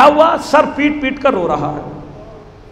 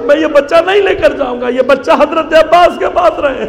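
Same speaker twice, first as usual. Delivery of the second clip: impassioned cry; 4 semitones higher, 4 dB louder than usual. Acted. Father refusing to take his healed son home, loud and impassioned. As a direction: declaim it like a man who cannot face his master. Grieving father's vow, preached.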